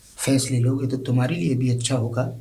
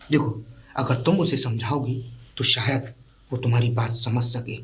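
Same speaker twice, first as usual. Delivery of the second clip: normal talking voice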